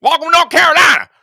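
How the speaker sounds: loud, high North Carolina holler; hammed-up North Carolina accent. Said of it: "you do that pretty damn good".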